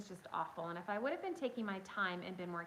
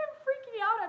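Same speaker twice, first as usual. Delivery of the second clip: freaking out; 14 semitones higher, 8 dB louder than usual. Retold or imitated.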